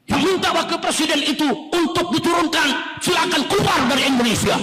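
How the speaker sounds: high-pitched, forceful mock order; stern official's retort. Official dismisses generals' demand, forceful and high. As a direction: order critics out like an indignant official.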